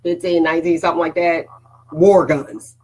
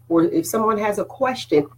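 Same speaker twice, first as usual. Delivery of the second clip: normal talking voice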